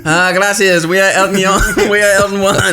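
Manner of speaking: high, put-on Spanish accent. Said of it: "worst Spanish accent anyone's ever done".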